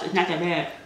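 ordinary talk, nothing marked

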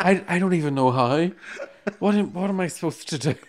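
mock innocent. Tipsy flyer fakes never having flown business, laughing innocence. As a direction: play the clueless newcomer, laughing. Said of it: "I said".

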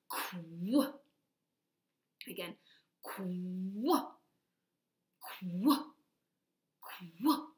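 "Qu" snapped out quickly over and over; fast qu drill; quick qu repeated. Shown as a demonstration. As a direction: hit the kw blend fast. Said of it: "Let's do a little faster".

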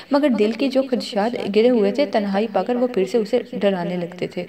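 ordinary delivery, nothing marked

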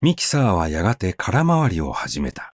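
ordinary delivery

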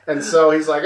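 ordinary delivery

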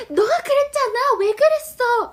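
high, loud angry yell; acted shouting again. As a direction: shout the blame at a friend. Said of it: "소리치다는 뭐라고?". (높고 크게 화내며 소리치기)

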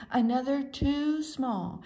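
plain reading voice

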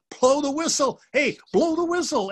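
high, loud shouted order; urgent yell. A narrator's reading. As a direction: yell it down at them as they fall. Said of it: "Everett yells at them".

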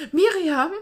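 Miriam's name called out loud and high; calling out. A narrator's reading. Girl calls out to her friend, loud and high. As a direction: call across the square to her friend. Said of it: "rief sie erst ziemlich leise".

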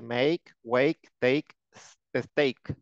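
Make, wake, take, stake like "ache" with only the first consonant changed; teacher drills Make, wake, take, stake rhyming with ache; rhyming pronunciation. Shown as a demonstration. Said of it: "va por el estilo de ache".

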